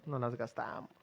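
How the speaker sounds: quiet aside. Real, not acted.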